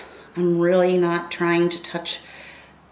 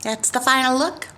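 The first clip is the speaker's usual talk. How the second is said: higher and louder, upbeat wrap-up; cheerful sign-off; 8 semitones higher, 4 dB louder than usual. Real, not acted. Vlogger signs off to her viewers, bubbly and loud. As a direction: beam out a happy goodbye.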